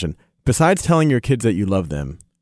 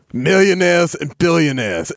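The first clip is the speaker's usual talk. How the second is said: Bernie Sanders impression; 7 semitones higher, 4 dB louder, slower than usual. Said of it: "Bernie Sanders".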